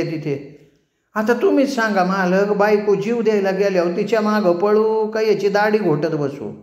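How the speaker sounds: whining barber. A narrator's reading. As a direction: complain sweetly to the boss. Barber complains about the shave.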